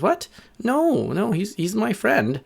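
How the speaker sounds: high, indignant little-bird protest. A narrator's reading. Little bird refuses their demand.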